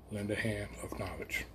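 slow breathy whisper to close. Shown as a demonstration. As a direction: whisper the closing lesson slowly. Closing line whispered slowly.

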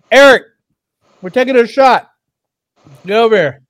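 shouting to someone in another room; calling out. Real, not acted. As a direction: yell to a friend down the hall.

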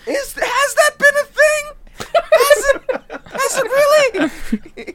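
very high, squeaky disbelief; incredulous. Real, not acted. Reacting to podcast's running balls topic, incredulous.

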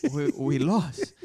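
slow, halting admission; stunned defeat. Retold or imitated. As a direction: break the bad news haltingly, in disbelief. Retelling stunned answer after loss.

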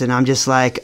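normal talking voice

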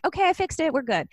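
mock-cheerful reassurance; breezy all-clear. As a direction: imitate someone suddenly fine and over it. Co-author's sudden recovery next day.

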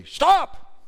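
sharp high yell with a laugh; sudden shout. Retold or imitated. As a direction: startle the crying child with a shout. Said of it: "I pick them gently up and say".

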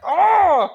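high, loud frustrated "ah"; reenacted scream of exasperation; exasperated yell. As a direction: yell out like you're losing it. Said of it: "bro, I almost lost my shit".

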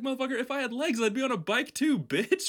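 high, loud furious yelling; furious rant. Retold or imitated. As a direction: rage at him, full and high. Ranting as the rider.

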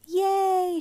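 put-on high, loud children's cheer; a burst of childish joy; kids cheering. A narrator's reading. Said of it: "shouted the kids".

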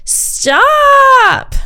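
very high, loud drawn-out yell; long shrieked stop from a little kid. A narrator's reading. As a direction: scream it like a five-year-old. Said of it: "the kid yells even louder".

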